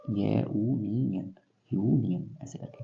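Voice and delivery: यूनियन said slowly, clearly starting with a "yu" sound; pronunciation demo. Shown as a demonstration. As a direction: draw out यूनियन so the opening "yu" is clear. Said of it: "उच्चारण करके देख लेते हैं इसका". (यूनियन का धीमा, साफ़ उच्चारण)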